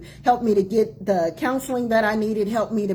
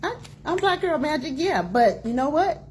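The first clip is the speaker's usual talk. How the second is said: sassy self-talk; 6 semitones higher than usual. Retold or imitated. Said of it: "in saying".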